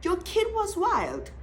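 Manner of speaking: slow, high, firm insistence; said with my chest. Real, not acted. Telling the viewers firmly: your kid was wild. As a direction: lean into "wild" with full conviction. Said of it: "I'm saying it with my chest".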